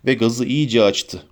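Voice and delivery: ordinary delivery, nothing marked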